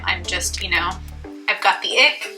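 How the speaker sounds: ordinary talk, nothing marked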